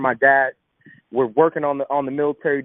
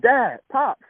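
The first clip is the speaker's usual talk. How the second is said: tearful call; 9 semitones higher than usual. Retold or imitated. Finding homeless father, crying.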